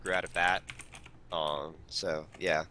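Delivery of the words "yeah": ordinary talk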